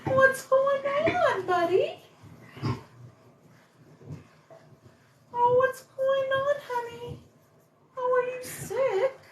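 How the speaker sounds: high, cooing voice for a dog. Real, not acted. Asking sick dog.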